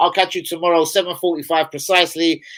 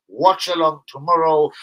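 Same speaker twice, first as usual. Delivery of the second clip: ordinary talk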